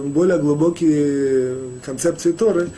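ordinary talk

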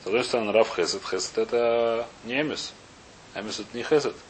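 usual speaking voice

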